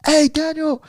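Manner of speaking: very high, hyped-up announcement; high, eager calling out; excited friend. Retold or imitated. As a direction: imitate a friend bursting with music news. Acting out friend's excited music news.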